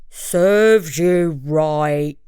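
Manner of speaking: slow, low bear growl. A narrator's reading. Bear growling at the trapped monkey, slow and low. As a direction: drag it out like a grumpy bear. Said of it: "he growled".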